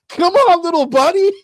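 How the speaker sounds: high, loud encouragement; root for the turtle at full volume; cheering mock yell. Quoting the cameraman cheering on the turtle, high loud yell. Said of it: "he said".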